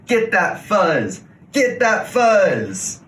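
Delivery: battle cry. Acted. Prairie dogs charging into the fuzz war, yelling. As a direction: chant it like a charging mob.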